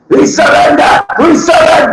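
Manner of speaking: high yelled surrender cry; hammed-up cry of surrender soldiers; mock Russian surrender. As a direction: shout it like beaten soldiers giving up. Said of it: "they'll go".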